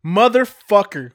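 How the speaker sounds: loud, drawn-out frustrated curse. Real, not acted. Cursing himself for misreading the label. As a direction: blurt it out, annoyed at yourself.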